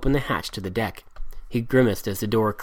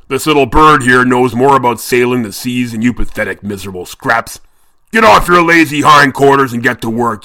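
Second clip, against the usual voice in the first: much louder, frightening captain's roar; Redbeard's bellow; 9 dB louder than usual. A narrator's reading. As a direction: bellow at lazy sailors. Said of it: "in Redbeard's frightening voice bellowed".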